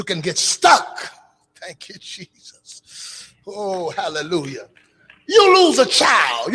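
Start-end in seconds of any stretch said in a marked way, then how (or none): 5.3-6.5 shouted line. Acted. Preacher shouting to the grieving women in church. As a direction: cry it out high and loud.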